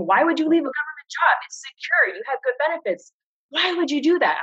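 high, incredulous mimic of the nay-sayers; imitating doubters. Mimicking coworkers who questioned her quitting, incredulous and high. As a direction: scoff at her like a worried colleague. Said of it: "people were saying".

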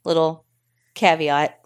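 ordinary delivery

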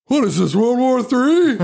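taxi driver outburst. Retold or imitated. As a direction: imitate a cabbie exclaiming, stretching the last words. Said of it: "hear this taxi cab driver go".

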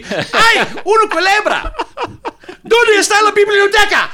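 high, loud, laughing shriek of fright; mock scream at a snake. A tourist in Mexico sees a snake and shrieks in mock terror. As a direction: squeal it like you just spotted a snake. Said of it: "I guarantee".

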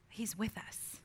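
a soft whisper of comfort; whispered assurance. Acted. Preacher comforting the weary congregation, in a whisper. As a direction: whisper it like a promise. Tender assurance after a pause.

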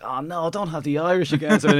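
lightly acted "oh no" reaction; mock dismay. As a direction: play an anxious viewer protesting at the screen. Acting out dismayed film viewer's reaction.

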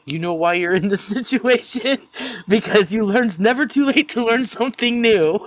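imitating Daniel while cracking up; laughing quote. Fan retelling Daniel's handcuff line, laughing.

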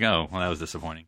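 low, soft, flat android delivery; unimpressed monotone, quiet; deadpan Data. Retold or imitated. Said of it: "carries it like".

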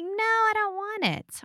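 high, slow, coy refusal; playing coy. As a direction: put on a half-hearted, high-voiced protest. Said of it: "has to play coy".

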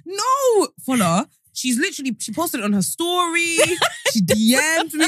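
high, protesting sister; insistent sister. Retold or imitated. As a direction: argue back, sure she's right. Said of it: "And she said".

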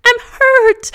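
put-on very high little-kid whine; whining to Daddy. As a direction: act out a pitiful hurt child. Acted child's cry to dad.

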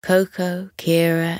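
usual speaking voice

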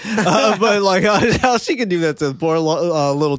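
laughing through the words; can't keep a straight face. Real, not acted.

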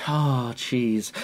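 soft, savouring sigh; hushed swoon over food; dreamy longing. Pretend. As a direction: murmur it in mock rapture. Echoing the swoon over cheese.

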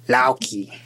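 Lauki with an ow vowel, not oh; Icelandic Loki. Shown as a demonstration. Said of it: "Loki would be more like".

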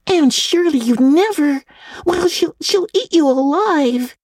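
put-on very high, shocked girl's voice; astonished girl. A narrator's reading. Diana stares at Anne's plan, high and shocked. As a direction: gasp it out in disbelief, high.

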